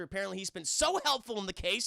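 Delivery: ordinary talk, nothing marked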